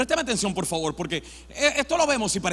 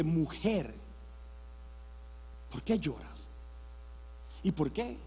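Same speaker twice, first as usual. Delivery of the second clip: low, very soft questions with long pauses; gentle Christ; 7 semitones lower, 13 dB quieter than usual. Acted. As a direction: ask it tenderly, almost hushed. Cristo habla tiernamente a María. (preguntas muy suaves, graves y tiernas)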